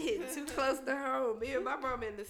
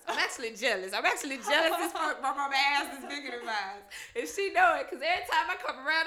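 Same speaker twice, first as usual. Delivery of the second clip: usual speaking voice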